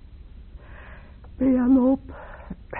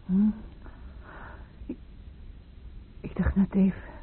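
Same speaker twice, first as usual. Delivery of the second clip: drowsy whisper; 7 dB quieter than usual. Acted. Just woken, drowsy.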